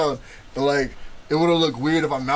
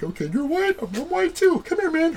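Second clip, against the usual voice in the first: imitated eager classmates; 14 semitones higher than usual. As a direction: pair up eagerly in a high mock voice.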